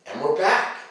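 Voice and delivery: usual speaking voice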